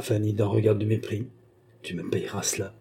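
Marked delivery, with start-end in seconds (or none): 1.8-2.7 murmured very low and softer, a threat; a quiet vow of revenge under the breath. A narrator's reading. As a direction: breathe it so the maid barely hears. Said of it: "murmura-t-elle tout bas".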